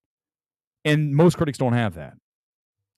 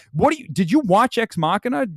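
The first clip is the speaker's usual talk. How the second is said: ordinary talk, nothing marked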